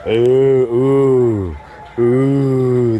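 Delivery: long, loud drawn-out "ooh"; swelling disapproving oohs, hammed up; mock crowd ooh. Comic echoing a disappointed audience, mock disapproving ooh. Mimics crowd as mood turns.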